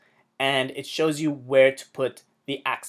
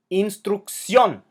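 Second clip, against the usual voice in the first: "Instrucción" slowly, high, as ins-truc-CIÓN; careful slow "Instrucción", weight at the end; stress on last syllable; 6 semitones higher, 3 dB louder, slower than usual. Shown as a demonstration. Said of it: "an emphasis on the last syllable".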